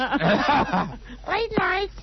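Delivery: imitating a high woman's voice; mock the caller's woman voice. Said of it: "in his woman's voice".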